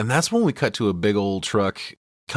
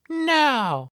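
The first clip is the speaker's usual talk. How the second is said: put-on high cutesy girl voice; 6 semitones higher than usual. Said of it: "she's like".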